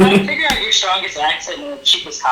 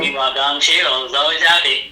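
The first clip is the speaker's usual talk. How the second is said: ordinary delivery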